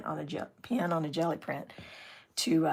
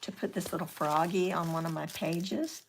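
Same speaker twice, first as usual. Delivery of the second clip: ordinary talk, nothing marked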